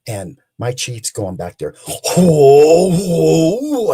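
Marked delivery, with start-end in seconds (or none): 1.9-3.9 high, loud alarmed whoa; retold frightened shout. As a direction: call out in alarm, voice climbing high. Chief calls out in fear.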